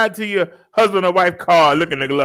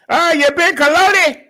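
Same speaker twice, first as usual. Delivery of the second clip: mock Indian accent; 11 semitones higher, 4 dB louder than usual. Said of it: "I was so mad at myself".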